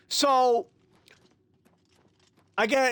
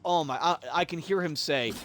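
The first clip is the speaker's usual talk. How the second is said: usual speaking voice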